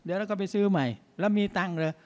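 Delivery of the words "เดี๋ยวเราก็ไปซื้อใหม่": lightly imitated easygoing father, pitched higher; unbothered. Father letting a wood thief be, unbothered. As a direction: shrug it off in a higher voice. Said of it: "แล้วไม่อะไรนะ ไม่อะไรกับใคร".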